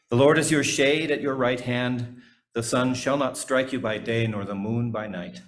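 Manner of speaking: normal talking voice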